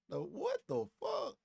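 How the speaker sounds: a what-the-hell yelp of disbelief; incredulous outburst. Real, not acted. Reacts to her custody demands.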